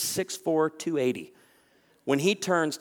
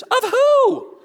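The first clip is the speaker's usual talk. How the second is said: much higher, louder suspicious squeal; suspicious woman; 10 dB louder than usual. Retold or imitated. Anxious woman questioning the photographer, high squeaky suspicion. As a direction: interrogate the camera holder in a squeaky voice.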